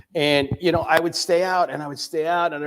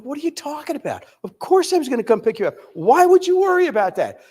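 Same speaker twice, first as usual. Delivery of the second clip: incredulous; 11 semitones higher, 4 dB louder than usual. Retold or imitated. Brushing off daughter's fear.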